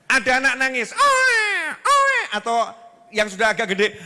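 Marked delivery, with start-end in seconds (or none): 0.9-2.2 much higher, shouted scold; yelling parent. Retold or imitated. Parent yelling at a crying child, high shout. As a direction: snap at the child to stop.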